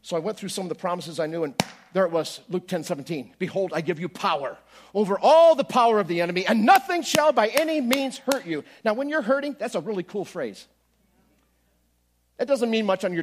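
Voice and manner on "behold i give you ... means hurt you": louder, building preacher's reading of the verse; scripture proclaimed. Acted. Preacher proclaiming Luke ten seventeen to the congregation. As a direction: declare it like a promise.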